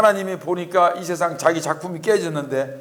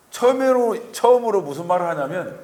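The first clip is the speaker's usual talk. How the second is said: usual speaking voice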